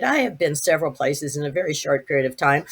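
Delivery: plain reading voice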